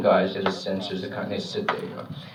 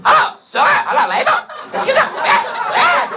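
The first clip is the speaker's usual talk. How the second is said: much higher, louder gossipy aunt voice; aunt on the phone; 7 dB louder than usual. Retold or imitated. Aunts with their gin rummy voices, high phone squeal. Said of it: "they talk that way on the phone".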